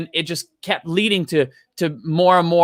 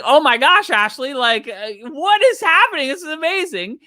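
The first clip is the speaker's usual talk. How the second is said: high, gushing amazement; excited gushing; 8 semitones higher than usual. Retold or imitated. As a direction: imitate her thrilled disbelief.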